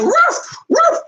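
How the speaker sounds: very high yappy woof; little dog bark. Retold or imitated. The chihuahua running off, a high yappy bark. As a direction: yap like a scared teacup chihuahua. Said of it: "She was like".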